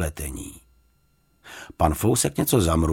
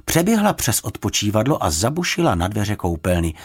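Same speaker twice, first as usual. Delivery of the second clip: plain reading voice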